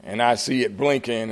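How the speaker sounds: usual speaking voice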